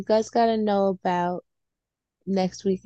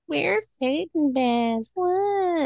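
mock poser; 9 semitones higher, much slower than usual. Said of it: "all these people are like".

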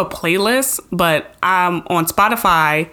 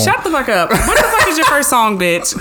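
laughing; 6 semitones higher than usual. Real, not acted. Laughing at friend's teasing.

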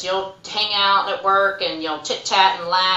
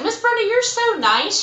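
sweet staff member; 14 semitones higher than usual. Retold or imitated. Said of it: "she's like".

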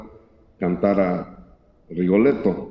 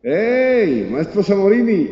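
calling across the street; 10 semitones higher, slower than usual. Retold or imitated. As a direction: hail him from afar. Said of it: "y le grita".

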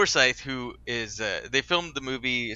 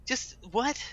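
baffled; 12 semitones higher, 4 dB quieter than usual. Real, not acted. Reacting in disbelief.

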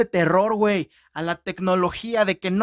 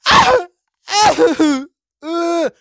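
high, loud hammed-up shrieks; parodying a hysterical girl; mock scream; 8 semitones higher, 7 dB louder, much slower than usual. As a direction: scream like a telenovela teen.

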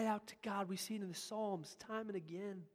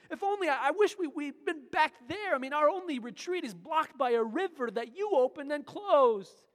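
loud, higher, pleading in despair; despairing lament; 5 semitones higher, 9 dB louder than usual. Acted. Joshua cries out to God in despair, loud lament. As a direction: cry out as if all is lost. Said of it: "cries out with despair and says".

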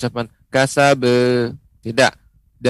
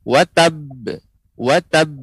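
"Watab" with a bouncing released final b, pressed hard; "Wa tab" final b stopped then popped out; qalqalah on wa tab; 4 semitones higher, 3 dB louder, slower than usual. Shown as a demonstration. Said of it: "penekannya juga harus ditekan dulu".

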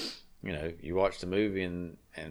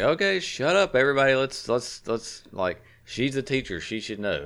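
yelling over the family, pitched way up; retold shout; 7 semitones higher, 8 dB louder than usual. Dad shouting over wife and son's homework fight. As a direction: shout everybody down, half joking.

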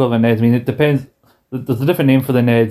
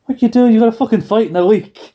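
mock angry coach; scolding; 10 semitones higher than usual. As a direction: tell him off sharply. Coach scolding a bruised fighter.

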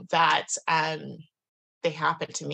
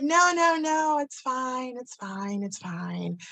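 put-on drunk woman; 6 semitones higher, slower than usual. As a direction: imitate a drunk stranger waving off help. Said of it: "she's super drunk".

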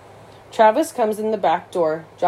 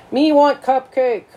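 high, slow monster voice; Cookie Monster; 6 semitones higher, 4 dB louder, slower than usual. Acted. Oliver flails about for a cupcake, hammed-up monster voice. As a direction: drag it out like a greedy puppet monster. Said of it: "That's Cookie Monster who talks like that".

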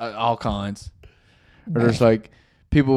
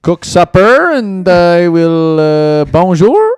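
mock Acadian Franglais; 5 dB louder, slower than usual.

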